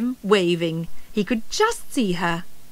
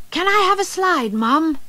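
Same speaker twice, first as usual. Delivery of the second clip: higher, quicker eager cub voice; cub asking; 7 semitones higher than usual. A narrator's reading. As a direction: ask Mum like a keen little cub. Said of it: "asked Euston".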